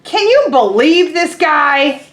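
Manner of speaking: loud, slow disbelief; fed-up pigeon appealing to the crowd; exasperated pigeon. Acted. Teacher voicing Pigeon complaining about the duckling, loud and slow.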